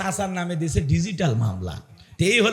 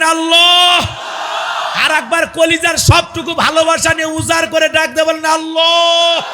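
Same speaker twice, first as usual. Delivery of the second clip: preaching at full force; 9 semitones higher, 4 dB louder than usual. Real, not acted. Preacher leading the crowd in calling Allah, fervent shouting. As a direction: cry out to the crowd with all your heart.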